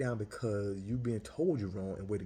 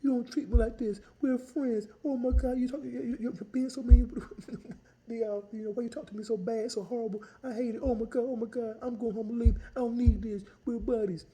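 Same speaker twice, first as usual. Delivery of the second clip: high, whiny hurt complaint; mock wailing vendor; 13 semitones higher, 6 dB louder than usual. Vendor scolding the organizer, high whiny hurt tone. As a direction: whine like a wounded friend. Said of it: "When she did going".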